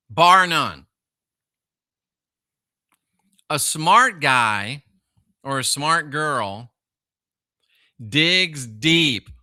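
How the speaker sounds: preacher's emphasis; emphatic. Real, not acted. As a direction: drive each word home.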